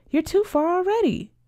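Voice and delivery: high-pitched, pleading complaint; plaintive protest. Acted. Friend objects to her moving farther away, plaintive protest.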